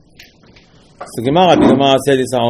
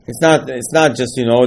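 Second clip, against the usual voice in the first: usual speaking voice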